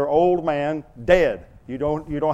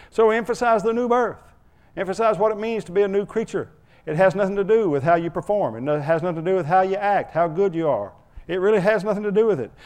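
higher, urgent preaching; fervent preaching; 5 semitones higher, 3 dB louder than usual. Acted. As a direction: press the point home. Preaching at full force.

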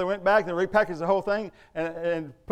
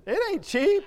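high, loud, mock-exasperated retort; squeaky incredulous comeback; 13 semitones higher, 5 dB louder, slower than usual. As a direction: fire back at the critic with a laugh. Preacher retorts to a critic.

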